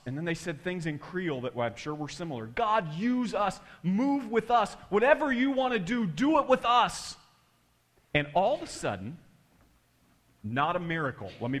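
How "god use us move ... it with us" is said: slow, high, loud prayer; imitated fervent plea. Kids offering themselves to God, imitated fervent prayer. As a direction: plead it out, building, as the kids. Kids' prayer with raised hands.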